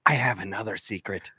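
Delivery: creepy low reveal. Acted. Steve creepily tells Marvin it wasn't a dream, low and soft. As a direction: let the secret creep out low.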